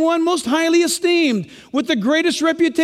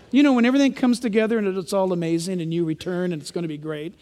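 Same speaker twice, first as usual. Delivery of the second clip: ordinary delivery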